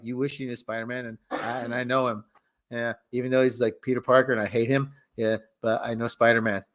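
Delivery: low, monotone muttered boast; Flash Thompson. Acted. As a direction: drone on defensively, low and soft. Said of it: "Flash Thompson's like".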